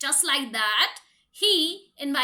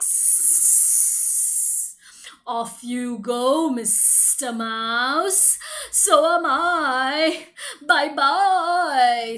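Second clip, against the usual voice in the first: scared snake; 2 semitones lower, 5 dB louder, much slower than usual. Acted. Frightened snake hurries away from the mouse. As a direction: hiss and hurry off like a frightened snake. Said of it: "Mister Snake was like".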